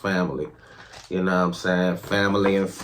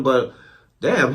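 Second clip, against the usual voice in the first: ordinary talk, nothing marked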